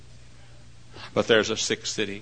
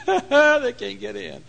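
high acted laugh running into the words; gloating laughter; 11 semitones higher, 6 dB louder, much slower than usual. Fugitive safe inside mocking his pursuers, high gloating laugh. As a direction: laugh at those locked out, drawing it out.

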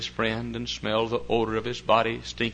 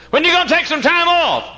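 high-pitched, loud mimicry; mock nagging; 9 dB louder than usual. People pushing preacher to play golf, mock nagging shout. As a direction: imitate a pushy questioner, pitched way up.

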